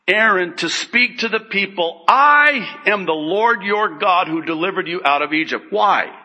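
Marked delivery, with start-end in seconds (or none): 2.1-5.7 I held long, high and loud; stress on I. Acted. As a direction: launch the sentence with a big, stretched I. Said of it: "I think the emphasis is on".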